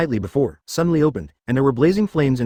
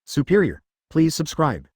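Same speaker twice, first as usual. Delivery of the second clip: ordinary delivery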